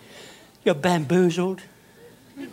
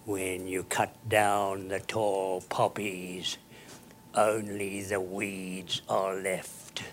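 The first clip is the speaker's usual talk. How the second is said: slow proverb; 7 semitones lower, 7 dB quieter, much slower than usual. Shown as a demonstration. Aphorism delivered slowly.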